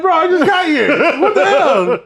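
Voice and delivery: very high, loud, drawn-out mock outrage; laughing, hammed-up disbelief; incredulous protest. Student kicked out on arrival by teacher, mock incredulous.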